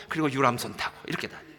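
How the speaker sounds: ordinary delivery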